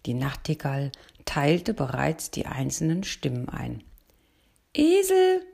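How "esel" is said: high, loud bossy bird call; commanding call. A narrator's reading. Nightingale orders the donkey to his place, high and loud. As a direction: bark the name from up high. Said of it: "rief sie befehlend".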